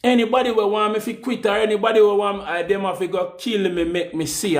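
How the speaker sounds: rattled off fast; quick defiant boast; rapid defiant challenge. Acted. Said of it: "repeats faster".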